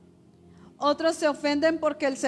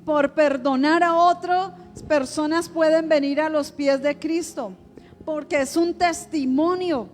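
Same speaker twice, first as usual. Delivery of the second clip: raised, driving preacher voice; fervent preaching; 3 semitones higher than usual. Acted. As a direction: proclaim victory with rising fervor. Preaches at full force.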